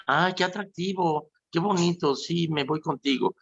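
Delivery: high, mock-swooning delight; gushing follower. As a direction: coo like someone easily dazzled. Voicing gullible followers.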